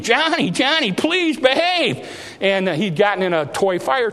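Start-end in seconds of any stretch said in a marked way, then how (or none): 0.0-2.1 high, slow pleading mother's plea; begging mother. Retold or imitated. Mother begging her screaming son in the toy department, high and pleading. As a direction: beg the child like a worn-out mom. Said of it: "And so she was just pleading".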